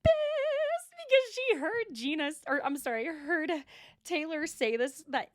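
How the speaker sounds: high, slow, loud squeal; squealed emphasis. Real, not acted. Stressing Heather's anger.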